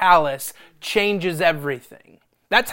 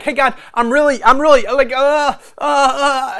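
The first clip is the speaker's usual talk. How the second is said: stammering plea; 8 semitones higher, 13 dB louder than usual. Retold or imitated. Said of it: "I lean into God like".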